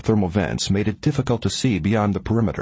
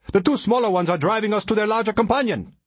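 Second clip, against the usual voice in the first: high, strained-up urgent explanation; shouted; 12 semitones higher than usual. A narrator's reading. Ari explaining the creatures' plan, urgent shout. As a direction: shout the warning with pitch held high. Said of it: "Ari shouted".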